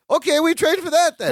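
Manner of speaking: a hint of the hopeful foreign cousin; Balky's eager voice. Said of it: "Balky goes".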